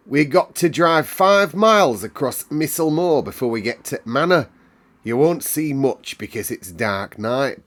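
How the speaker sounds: high, loud Yorkshire Medlock; Yorkshire housekeeper. Acted. Medlock tells Mary about the moor, Yorkshire. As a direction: tell the child gruffly.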